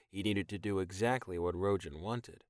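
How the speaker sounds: usual speaking voice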